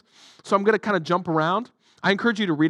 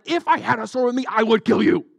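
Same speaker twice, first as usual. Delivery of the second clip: shouted; 4 semitones higher than usual. Acted. Balaam to his donkey, shouting angrily. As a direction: yell it at the donkey in fury. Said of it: "Balaam shouted".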